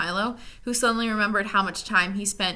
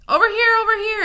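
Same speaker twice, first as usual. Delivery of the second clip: high, loud beckoning call; distant voice calling them over; 13 semitones higher, 9 dB louder, faster than usual. A narrator's reading. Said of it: "advised the voice".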